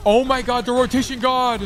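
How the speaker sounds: high, loud hyped cheer; excited shout. Real, not acted. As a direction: yell it out like a big play. Praising teammate's rotation, hyped take.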